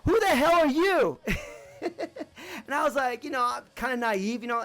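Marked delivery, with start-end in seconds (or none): 0.0-1.2 high, loud demanding shout; retold yell. Soldier confronting the new private, loud and high. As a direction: bark it like an angry soldier. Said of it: "he yells at me".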